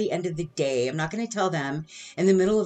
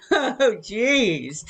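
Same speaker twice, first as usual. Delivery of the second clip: laughing; 4 semitones higher, 4 dB louder than usual. Real, not acted. Laughing at her own timing.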